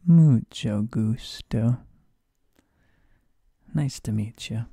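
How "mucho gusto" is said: mucho gusto slow and loud, stress on mucho; slow teacher demo. Shown as a demonstration. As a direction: say mucho gusto slowly, leaning on mucho. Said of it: "one other you may not know".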